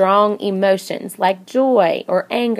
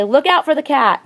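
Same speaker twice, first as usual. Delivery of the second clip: urgent call of look out; alarmed shout, out stressed; emotional warning; 7 semitones higher, 3 dB louder than usual. Shown as a demonstration. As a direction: warn someone about the cat, emphasis on out. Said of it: "That's kind of an emotional voice".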